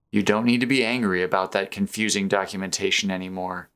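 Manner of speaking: usual speaking voice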